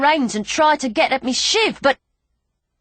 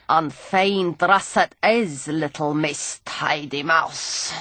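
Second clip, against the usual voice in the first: put-on sneering old drunk; 5 semitones lower than usual. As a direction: taunt her like a grimy old rogue. Gully's voice.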